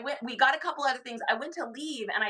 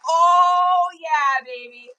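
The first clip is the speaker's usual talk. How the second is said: put-on loud exultant shout, fading on baby; gleeful triumph; 5 semitones higher, 10 dB louder, much slower than usual. Seller recalling a winning bid, loud gleeful crow. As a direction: crow over a steal. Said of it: "I was like".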